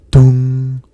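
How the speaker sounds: loud, booming "dong" held on one note; clock chime. A narrator's reading. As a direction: imitate a striking clock, ringing out the hum. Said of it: "rang the clock".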